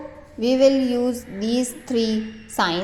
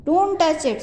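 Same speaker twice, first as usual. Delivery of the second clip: high, loud warning cry; shouted; 7 semitones higher, 3 dB louder than usual. Shown as a demonstration. Teacher repeating the boy's warning, shouting. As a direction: call out to stop him. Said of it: "So it is a shocking sentence".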